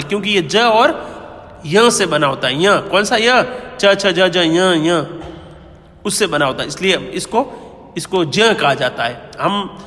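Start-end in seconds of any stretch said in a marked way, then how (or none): none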